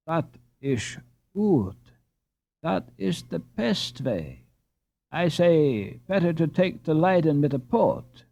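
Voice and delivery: put-on a light Dutch accent, slow and deliberate; good-natured Dutch accent, unhurried. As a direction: read Jacob with a mild Dutch accent. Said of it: "retorted Jacob".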